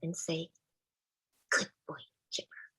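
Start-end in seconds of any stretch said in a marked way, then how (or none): 1.5-2.6 quiet praise. Retold or imitated.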